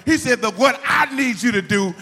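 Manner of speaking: loud, high-pitched sermon shout; full-force preaching. Real, not acted. Preacher tells how father said to call on him, shouting. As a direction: drive it home to the congregation at full volume.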